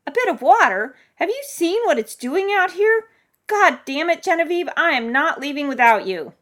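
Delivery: high, loud, incredulous outburst; frustrated, urgent yelling; exasperated shouting. Acted. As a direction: argue at full volume with a stubborn neighbor. Exasperated rescuer arguing over storm.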